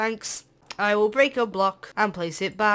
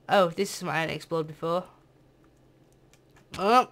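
ordinary talk

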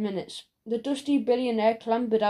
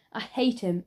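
plain reading voice

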